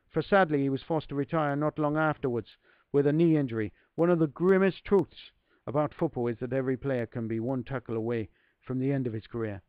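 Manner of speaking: put-on a modest, self-aware Irish accent. Reading Terry Neill's words aloud, in a light Irish accent. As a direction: lean gently into a Southern Irish voice. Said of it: "my Irish accent is really poor".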